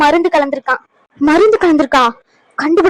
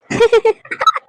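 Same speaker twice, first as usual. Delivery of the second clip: high, delighted giggle; a little triumphant villain's laugh; gleeful laugh; 8 semitones higher than usual. Acted. Schemer laughs to herself at the good news, gleeful giggle.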